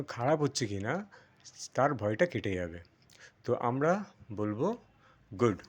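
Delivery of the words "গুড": গুড with a quick, short vowel; short GOOD. Shown as a demonstration. Teacher recapping the right sound, short গুড. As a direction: keep গুড brief. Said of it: "short করে দেবো".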